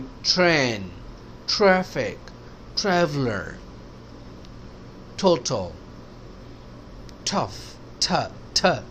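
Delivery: train, traffic, traveler with a puff of h on T; spaced examples, each T released with breath; aspirated T. Shown as a demonstration. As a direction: say Total and tough with airy T. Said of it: "تھوڑا ایچ ہے اس میں".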